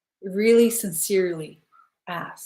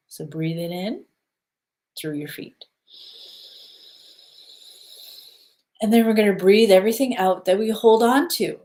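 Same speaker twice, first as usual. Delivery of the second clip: guided breathing. Acted. Leads a grounding breath exercise.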